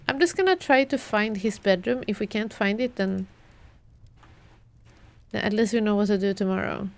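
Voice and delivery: ordinary talk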